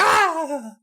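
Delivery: sudden high cry; high frustrated yell. Retold or imitated. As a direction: let out a mock wail. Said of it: "she's just like".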